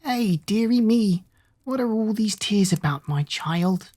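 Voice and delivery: put-on an old beggar woman soothing a crying girl; croaking old woman. A narrator's reading. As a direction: coo it slowly like a frail old woman. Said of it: "she continued".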